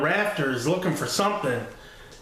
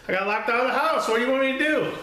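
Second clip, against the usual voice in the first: put-on much higher, fast, pleading kid voice; desperate whine; 9 semitones higher, much faster than usual. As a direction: squeak it out like a cornered boy begging dad. Kid pleads with angry dad.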